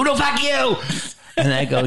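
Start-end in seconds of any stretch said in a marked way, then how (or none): 0.0-0.8 high-pitched mock outburst; so mad. Writing partner snaps back when told to calm down, imitated as furious. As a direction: imitate a furious, defiant retort. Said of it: "you were so mad".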